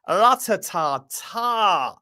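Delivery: slow, stretched-out "ra-ta-ta-ta"; drawn-out trill of admiration. Acted. Streamer raving about the girls in Texas, drawn-out admiring trill. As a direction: roll it out slowly, savouring every beat.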